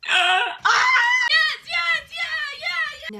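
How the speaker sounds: high squealing yes; fangirl shriek. Real, not acted. Fan reacting to trope, squealing shout. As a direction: squeal with delight.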